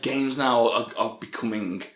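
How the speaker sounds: usual speaking voice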